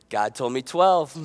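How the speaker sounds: low, understated mock-pious reply; deadpan kid comeback. Kid countering the parent's curfew, deadpan. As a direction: answer back low and cool. Child's smug comeback in joke.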